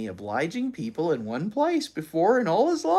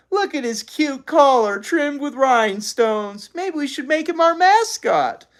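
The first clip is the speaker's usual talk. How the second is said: put-on gushing girl; 11 semitones higher, 5 dB louder than usual. A narrator's reading. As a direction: coo over a cute puppy. Girl gushing about dog.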